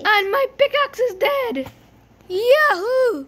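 high, loud whoop; triumphant cheer. Real, not acted. Player celebrating despite a broken pickaxe, loud triumphant cheer. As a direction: cheer it out as a victory.

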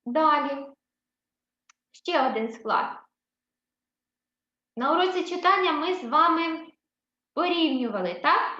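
ordinary talk

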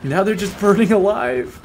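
normal talking voice

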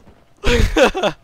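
high shrieking burst of laughter; loud laugh. Real, not acted. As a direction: cackle loud in relief. After fleeing the mines.

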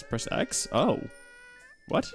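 normal talking voice

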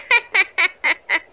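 staccato scheming cackle; villainous laugh. Acted. Hyena pleased with his plan, villainous laugh. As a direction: laugh over a wicked plan.

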